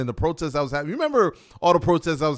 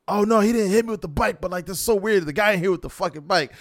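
surprised denial; 7 semitones higher than usual. Retold or imitated. Friend replies, confused.

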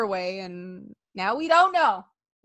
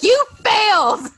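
mock taunt; 10 semitones higher, 7 dB louder than usual. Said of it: "He's like".

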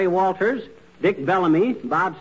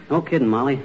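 usual speaking voice

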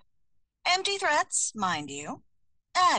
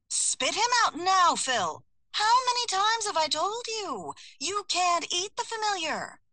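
scolding; 9 semitones higher than usual. Acted. Narrator as Halliday scolding Phil the cat, high exasperated telling-off. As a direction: tell the cat off like a fed-up owner.

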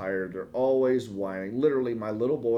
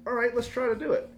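agreeing eagerly; 12 semitones higher, faster than usual. Retold or imitated. Dad eagerly agreeing with his son, mock eager. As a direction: jump at his idea. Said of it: "I was like".

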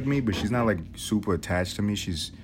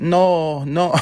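put-on grandma's no with a Spanish accent, higher and louder; 8 semitones higher, 6 dB louder than usual. Grandson imitating grandma in a Spanish accent. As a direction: redo it with more Spanish accent. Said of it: "More Spanish accent".

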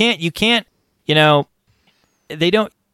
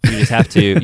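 normal talking voice

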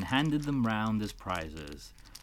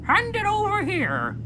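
dodo voice; 8 dB louder than usual. Acted.

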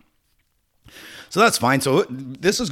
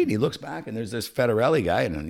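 usual speaking voice

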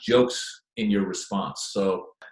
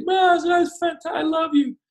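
much higher, louder mock drunk fan; drunk gushing fan; 6 dB louder than usual. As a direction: gush like a tipsy superfan.

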